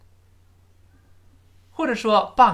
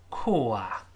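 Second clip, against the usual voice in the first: whisper; 3 semitones lower, 4 dB quieter than usual. Acted. Second speaker answers in whisper.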